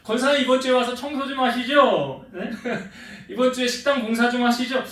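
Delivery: hammed-up pastor nudging a deaconess; pretend request. Pastor pretending to ask deaconess to clean, coaxing.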